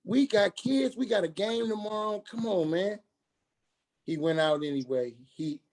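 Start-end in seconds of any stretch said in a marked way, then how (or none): 0.1-3.0 scolding a friend. Retold or imitated. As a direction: lecture him like you're fed up, pitch up. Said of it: "I was just going in on him".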